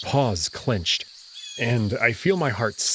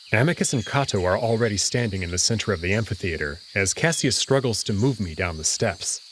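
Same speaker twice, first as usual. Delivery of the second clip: usual speaking voice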